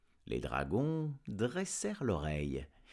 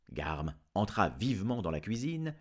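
usual speaking voice

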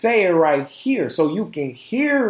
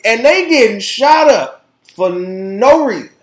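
ordinary talk, nothing marked

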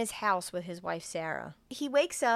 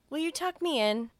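put-on higher little-boy voice; child voice; 7 semitones higher than usual. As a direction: ask sweetly like a small son. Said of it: "And he's like".

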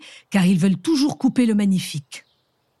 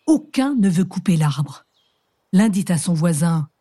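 ordinary delivery

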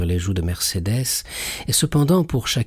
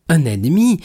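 a high, loud outraged echo; indignant cry; 7 semitones higher, 5 dB louder than usual. A narrator's reading. Mercédès outraged at Edmond calling her cousin an enemy. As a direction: throw the word back at him. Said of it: "s'écria Mercédès avec un regard de courroux".

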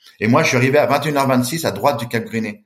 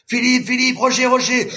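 very high shouted names; frantic calling; 12 semitones higher, slower than usual. Retold or imitated. Rescuers calling his name in the storm, very high and frantic. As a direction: call out in panic.

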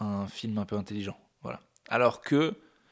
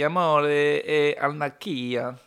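Italian pronunciation; 3 semitones higher, 5 dB louder, much slower than usual. Shown as a demonstration. Says the Italian title.

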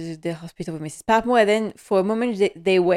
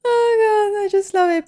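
gushing delight; 13 semitones higher, 4 dB louder than usual. Real, not acted. Fan gushing over scene.

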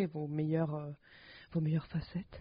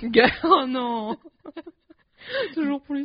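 laughing; 7 semitones higher, 10 dB louder, slower than usual. Real, not acted. Cracking up at slang words.

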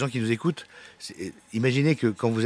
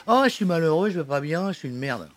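mock whining; 9 semitones higher, 5 dB louder than usual. Mocking whiny patients at psy.

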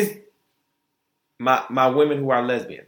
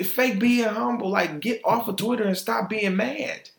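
normal talking voice

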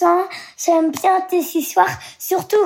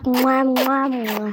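three smacking kisses, the last one fading; blown kisses; slower than usual. Real, not acted. Child blows kisses.

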